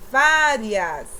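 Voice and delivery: "Várias" very loud on "Vá"; stress on first syllable. Shown as a demonstration. Teacher demonstrating stress to pupils, loud stretched "Vá". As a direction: punch the opening "Vá" of Várias. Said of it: "Antepenúltima sílaba".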